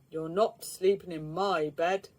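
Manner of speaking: a booming big bear voice; the big bear refusing his bed to Mister Lazy. A narrator's reading. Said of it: "boomed the big bear".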